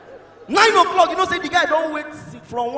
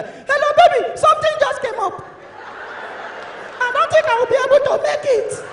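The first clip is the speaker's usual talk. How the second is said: put-on high-pitched girlfriend voice; cancelling girlfriend; 13 semitones higher than usual. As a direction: make a dainty, high excuse on the phone. Girl cancels on the phone.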